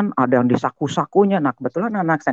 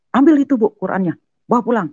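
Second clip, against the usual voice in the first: retold stern order; 10 semitones higher than usual. Retold father ordering things removed.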